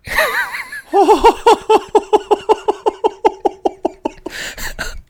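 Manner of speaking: long, high cackling laugh; shrieking laugh at the reveal; high burst of laughter. Real, not acted. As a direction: crack up in disbelief. Hears the subpoena was real.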